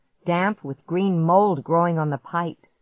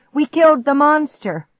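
loud, high victory cry; cheering; 10 semitones higher, 8 dB louder than usual. A narrator's reading. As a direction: shout it out in triumph. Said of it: "I cheered".

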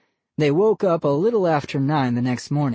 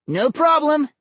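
higher shout through the wall; 13 semitones higher, 4 dB louder than usual. Acted. Vinny answering his father through the wall, shouting. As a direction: call it back loud. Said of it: "Vinny shouted through the wall".

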